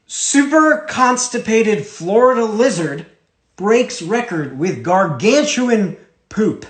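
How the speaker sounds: slow, high, grandly announced headline; mock-dramatic headline read. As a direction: read it out slowly as momentous breaking news. Reads an absurd headline for effect.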